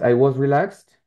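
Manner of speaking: I was relaxed said slowly and clearly; relaxed with a crisp kst ending; model sentence for repeating. Shown as a demonstration. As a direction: model "was" with a closed vowel, as in /wɑz/. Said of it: "Ahí otra vez".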